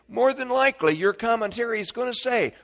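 normal talking voice

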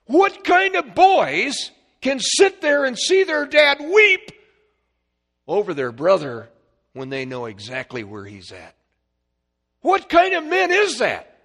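high, loud preaching that climbs to a shout; full-force preaching. Acted. Preacher pounding out the mystery of Christ to the congregation, full-force high shout. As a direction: preach it out with everything you have.

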